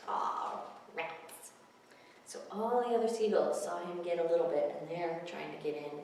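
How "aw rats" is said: whispered rats. Acted. Reader voicing the gull.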